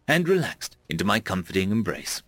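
ordinary delivery, nothing marked

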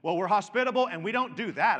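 defensive retort. Retold or imitated. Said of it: "can look at this and go".

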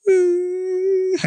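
long high-pitched whimpering hum; mock crying whimper. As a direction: hold a high, thin "mm" as if holding back tears. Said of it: "I was kinda crying".

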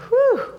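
high excited exhale, whew. Real, not acted. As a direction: let out a high joyful whew. Remembering salvation joy.

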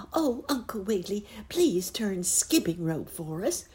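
high whispered plea; little girl begging. A narrator's reading. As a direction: beg sweetly like a small guinea pig girl. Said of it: "begged Bright Eyes".

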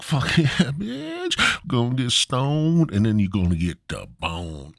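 put-on high, laughing old grandpa voice. Comedian voicing his grandpa bragging to the kids, high and laughing. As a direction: cackle it out like a randy old grandpa. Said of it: "he's like".

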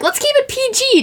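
high, prim teacher voice; a high mock-teacher "let's keep it PG". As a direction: squeak it out like a prissy teacher. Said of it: "teachers are like".

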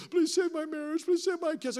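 very high-pitched imitation of desperate begging; mock pleading. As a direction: beg in a put-on high voice. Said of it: "people come into my office".

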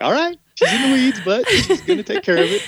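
very high, hammed-up resigned voice; mock resigned boss. Manager giving up on helping Kate, high hammed-up voice. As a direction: shrug it off in a high put-on voice. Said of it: "And I'm like".

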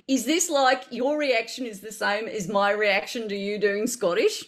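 convincing posh Australian; educated Australian accent. Shown as a demonstration. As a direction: keep up the put-on Australian. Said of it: "hear me doing Australian".

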